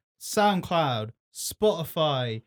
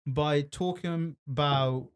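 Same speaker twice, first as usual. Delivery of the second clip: ordinary delivery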